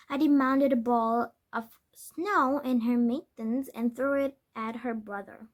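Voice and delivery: usual speaking voice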